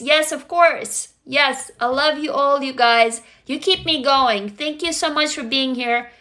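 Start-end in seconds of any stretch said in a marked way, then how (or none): none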